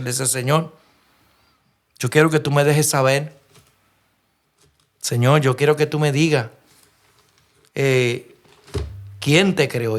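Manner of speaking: ordinary talk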